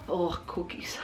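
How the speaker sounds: breathy whispered craving. Real, not acted. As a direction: whisper the word cookies.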